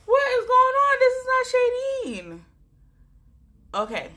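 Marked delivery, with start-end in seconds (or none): 0.0-2.4 high, loud, tearful wail of confusion; crying in disbelief. Retold or imitated. At work, crying over sweet music, bewildered tearful wail. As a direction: cry it out, baffled by her own tears. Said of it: "I'm at work and I'm crying".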